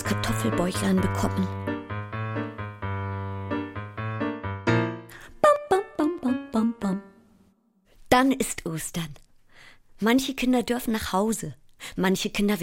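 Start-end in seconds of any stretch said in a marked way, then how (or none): 5.4-7.0 sung bam chime. Acted. Child marking that Easter has come, chanted chime. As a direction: voice a descending row of bell notes.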